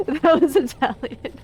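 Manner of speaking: high, laughing words; breaking up laughing at own joke. Real, not acted. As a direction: squeeze the words out through real laughter. Laughing at own bad accent.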